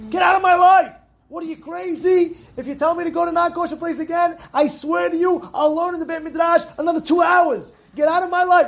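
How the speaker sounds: high, loud furious shouting; angry yelling. Retold or imitated. Friend yelling at his Yetzer Hara, furious and loud. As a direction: drive the tempter away with a yell. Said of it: "This is what he's telling the Yetzer Hara".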